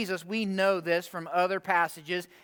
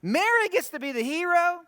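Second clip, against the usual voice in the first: very high, squeaky disbelief; incredulous outburst; 10 semitones higher, 4 dB louder than usual. Acted. Reader objecting to Mary being the hero, incredulous. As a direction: shoot the pitch right up, mock-astonished. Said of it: "you read it and you're like".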